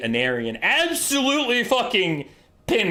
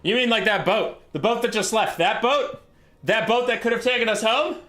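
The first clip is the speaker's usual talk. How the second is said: incredulous; 7 semitones higher than usual. Acted. General realises boat has left.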